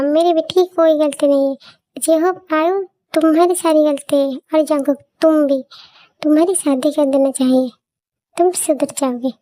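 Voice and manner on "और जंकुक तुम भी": usual speaking voice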